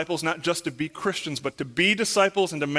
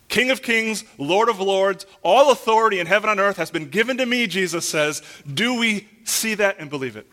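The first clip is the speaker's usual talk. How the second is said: raised, forceful pulpit proclamation; emphatic preaching; 4 semitones higher, 6 dB louder than usual. Real, not acted. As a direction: proclaim it boldly to the congregation.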